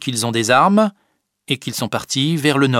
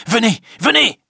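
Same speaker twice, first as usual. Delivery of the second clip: high, loud urging call; urgent call; 10 semitones higher, 4 dB louder than usual. Acted. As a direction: hurry them along. Briand urges the others to come. (appel pressant et fort)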